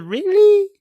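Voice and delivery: very high, loud squeaked question; sceptical disbelief. Retold or imitated. Host doubting the rent-free claim, squealed disbelief. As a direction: shoot the pitch right up in exaggerated disbelief. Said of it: "I said".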